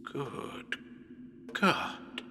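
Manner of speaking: breathless whispered Good God; unvoiced whisper of Good God; horrified whisper. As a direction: gasp it out in shock. Horror at blood on hand.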